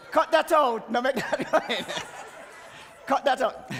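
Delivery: high, loud, helpless laughing at a slip. Real, not acted. Speaker asking for the slip to be cut, laughing. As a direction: call it out while cracking up.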